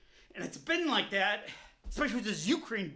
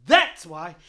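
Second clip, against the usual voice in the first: "That's why" punched out much louder; loud emphatic repeat; 4 semitones lower, 12 dB louder than usual. Real, not acted. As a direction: hammer the point home loudly.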